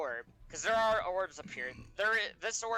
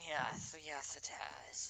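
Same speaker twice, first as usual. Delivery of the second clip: whispered dragon; 2 semitones lower, 8 dB quieter, slower than usual. Acted.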